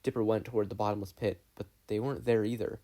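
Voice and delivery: plain reading voice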